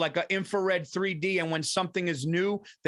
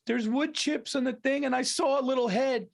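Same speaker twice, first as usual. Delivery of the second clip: high-pitched upset wife impression; imitating crying wife; 7 semitones higher than usual. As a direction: mimic a tearful wife, pitched way up. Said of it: "standing over me the other day crying".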